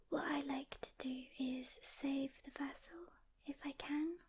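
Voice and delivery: normal talking voice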